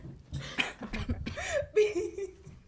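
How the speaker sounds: high, helpless laughter; long breathless laugh; real laughing fit. Real, not acted. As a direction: crack up, unable to carry on. Carried away, cracking up.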